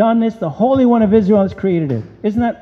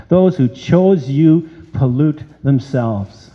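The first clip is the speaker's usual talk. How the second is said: normal talking voice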